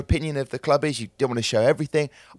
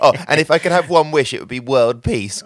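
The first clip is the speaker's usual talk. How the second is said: teasing, louder mock wish; jokingly earnest, pitched up at first; mock idealism; 3 semitones higher, 7 dB louder than usual. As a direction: play up a pageant-style answer. Mocking guest's earnest podcast answers.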